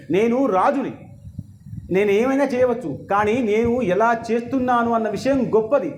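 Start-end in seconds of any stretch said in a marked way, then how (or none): none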